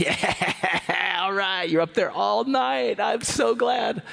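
slow gloating tease; mocking big brother. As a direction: drag it out to rub it in. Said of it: "he'd be like".

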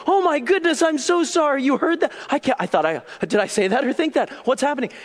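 high, loud, mortified dinner guest; flustered apology. Acted. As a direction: blurt it out in mock panic, stumbling over excuses. Flustered guest caught thinking aloud.

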